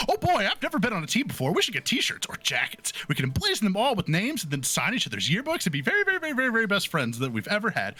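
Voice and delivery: put-on high, breakneck gnome-girl babble; excited chattering. A narrator's reading. Gnome girl chattering to anyone who'll listen, high and giddy. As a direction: gush on fast and high without stopping for air. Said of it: "She chatters seemingly to herself".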